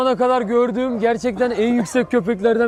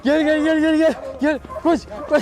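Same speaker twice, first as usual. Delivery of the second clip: calls rising higher, then urging; excited calling; 6 semitones higher than usual. Real, not acted. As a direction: build up excitement calling the dog. Keeps calling the running dog. (heyecanla köpeği çağırma)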